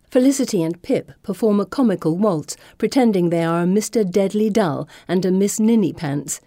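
plain reading voice

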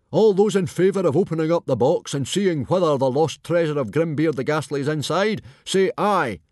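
loud, high rallying call; chief calling a vote. A narrator's reading. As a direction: call the whole hall to vote.

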